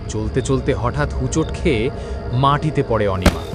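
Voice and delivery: usual speaking voice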